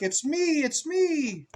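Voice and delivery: high, cheery little duck voice. Acted. Duck revealing itself to the child, high and cheery. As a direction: chirp it like a proud duck.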